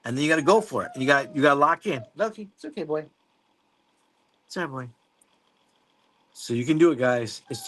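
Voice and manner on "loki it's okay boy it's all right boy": soothing a scared dog. Real, not acted. Calming his dog mid-stream.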